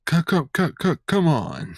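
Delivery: high mock stammer into a drawn-out word; imitated stuttering cry. Recalling a reaction to the arrest news, imitated stammering cry. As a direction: stutter it high, then let it out. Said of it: "all I just thought of was".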